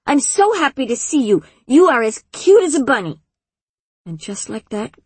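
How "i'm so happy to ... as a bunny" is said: put-on high, delighted young girl; little girl voice. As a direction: gush like a happy small child. Delighted child greets Glurk.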